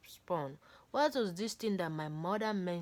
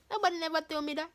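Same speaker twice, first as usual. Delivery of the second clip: higher, fast, fed-up protest; fast exasperated; 10 semitones higher, 4 dB louder, faster than usual. Real, not acted. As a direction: rush it out, fed up and high. Exasperated aside.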